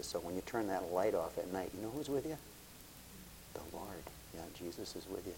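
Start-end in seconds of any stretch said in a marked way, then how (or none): 3.5-5.3 whispered reassurance, softer; hushed. Real, not acted. To a scared listener at night, whispered comfort. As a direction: breathe it gently like a secret.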